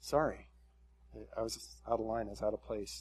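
soft, low, sheepish apology; humbled. Acted. Preacher voicing Job stepping back before God, humbled and quiet. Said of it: "Job's kinda humbled and steps back".

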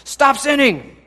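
hammed-up finger-wagging scold; preacher mocking self-flagellation. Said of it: "flog any of you guys".

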